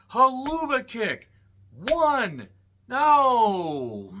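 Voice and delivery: high, slow excited play-by-play call; mock commentator call. Reviewer mimics the live match call, excited. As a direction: call the near-fall like a ringside announcer.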